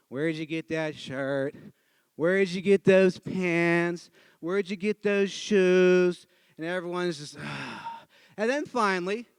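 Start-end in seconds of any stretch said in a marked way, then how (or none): 0.1-6.3 slow, droning skit questions; bored run-through. Retold or imitated. Actors going through the tired skit, bored drone. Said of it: "absolutely bored to tears". 7.4-8.0 soft, low exhale of boredom; bored sigh. Retold or imitated. Audience sitting through the skit, bored sigh.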